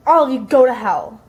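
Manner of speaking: loud mock outburst, retelling a quarrel; angry outburst. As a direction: lash out loudly as an imitated angry teen. Retold quarrel, Eli lashes out.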